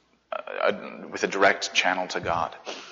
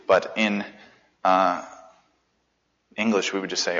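normal talking voice

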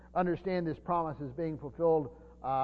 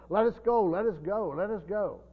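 eager Gentiles urging; 5 semitones higher, 6 dB louder than usual. Pretend. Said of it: "Can you imagine Gentiles saying".

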